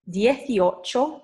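slow, drawn-out "Dieciocho", rising at the end; modelled for repetition. Shown as a demonstration. Teacher giving learners a number to repeat, slow and deliberate. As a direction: stretch out "Dieciocho" with a lifted ending. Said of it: "after me".

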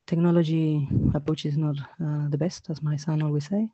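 quietly talking herself through the tech; muttering to self. Real, not acted. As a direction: mumble it aside as you work the screen. Muttering to herself sharing screen.

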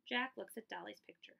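plain reading voice

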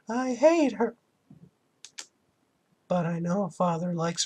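put-on high young girl voice; Aya voice. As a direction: sad little girl grieving mom. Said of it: "creepy Mickey Mouse".

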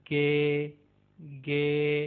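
"गे" with a hard G as in God; Turkish G. Shown as a demonstration. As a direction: say "गे", not "जी". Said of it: "इसको जी नहीं बोलना". ("गे" गॉड वाली आवाज़ में)

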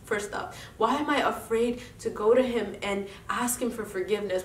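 ordinary talk, nothing marked

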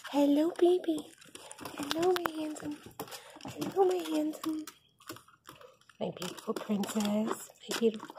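soft cooing. Real, not acted. Cooing softly at cats.

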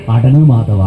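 low, self-soothing under the breath; calm yourself down. Shown as a demonstration. Speaker to parents, showing how to calm anger, low and soothing. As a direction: talk your own anger down, low and firm. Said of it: "മക്കളോട് ദേഷ്യം വന്നാ ഒറ്റ വർത്താനം".

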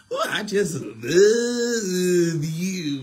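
slow, high, gushing mock adoration; mocking gushing fan. Preacher mimicking a fan who gushes but never donates, slow and loud. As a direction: ham up a fan gushing over you. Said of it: "Don't tell me".

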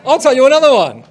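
loud, high call to the crowd; rallying shout. Real, not acted. Host promising audience another joke, rallying shout. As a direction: shout over the groans to move on.

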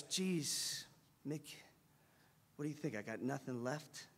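very soft, low, wounded boxer; subdued question. Retold or imitated. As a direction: ask your coach quietly, deflated. Hurt boxer asking his coach.

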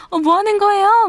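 high, loud mock-startled cry. Partner startled by a sudden hug, imitated in high alarm. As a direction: imitate a partner jumping in surprise. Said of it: "놀래더니 막".

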